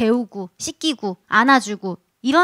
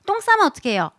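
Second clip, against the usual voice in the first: ordinary delivery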